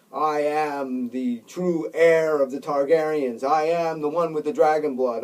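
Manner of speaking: stern heir laying claim to the dragon. Acted. Jon sternly tells the dragon he is the true heir. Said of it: "maybe he talks sternly to the dragon".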